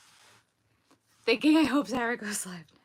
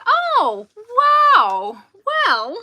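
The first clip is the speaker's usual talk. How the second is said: mock surprise; 12 semitones higher, 14 dB louder, much slower than usual. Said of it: "I was like".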